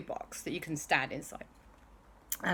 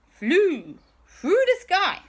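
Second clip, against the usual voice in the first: higher, louder, slow narration; dramatic build; 11 semitones higher, 7 dB louder, slower than usual. A narrator's reading. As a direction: draw out the flight grandly. Dramatic storytelling.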